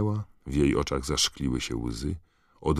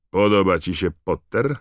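loud, raised voice, lifting on the name; loud taunting question; 5 semitones higher, 7 dB louder than usual. A narrator's reading. Malfoy asks Harry about the badges, loud taunt. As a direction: call out the taunt. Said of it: "zapytał głośno Malfoy".

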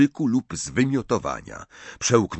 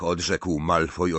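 ordinary delivery, nothing marked

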